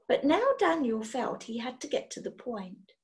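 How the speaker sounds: ordinary delivery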